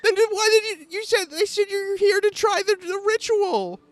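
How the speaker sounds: high, tearful accusation; upset druid accuses Esme, tearful and high; crying npc. Acted. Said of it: "crying npc".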